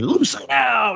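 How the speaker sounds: high, hammed-up healer command; mock faith healer. Mimicking the American evangelist praying over a deaf woman, hammed-up high. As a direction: imitate a preacher commanding deaf ears to open. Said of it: "typical sort of, you know, fingers in the ears".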